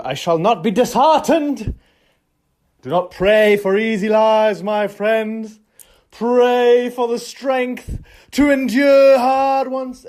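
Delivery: high, drawn-out theatrical proclamation; mock-heroic declamation. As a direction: declaim it like a grand rallying speech. Declaims a heroic motto mid-ramble.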